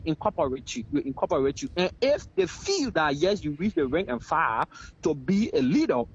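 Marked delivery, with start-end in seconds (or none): none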